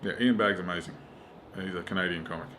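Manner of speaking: ordinary delivery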